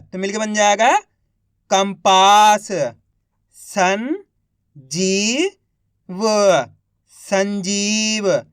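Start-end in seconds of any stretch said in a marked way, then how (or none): 3.5-6.2 each syllable drawn out very slowly; slow syllable-by-syllable reading. Shown as a demonstration. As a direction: sound out सुरंग slowly as सु-रं-ग, one sound at a time. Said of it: "एक अक्षर का उच्चारण करके".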